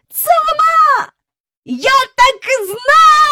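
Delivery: високо, гучно й повільно кричить; тріумфально закричи; радісний крик. A narrator's reading. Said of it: "закричав Майкл". (Майкл радісно кричить)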